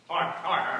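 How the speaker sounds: ordinary talk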